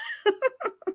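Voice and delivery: high, breathy giggle of relief; kind gentle laugh. Real, not acted. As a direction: laugh softly and kindly. Laughs after reconnecting.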